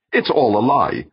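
high, loud denunciation; insistent. A narrator's reading. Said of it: "Meek insisted".

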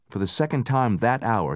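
usual speaking voice

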